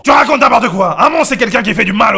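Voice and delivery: shouted retort. Acted. Heroine scolding the vampire, shouted. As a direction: shout him down.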